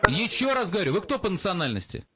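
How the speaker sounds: normal talking voice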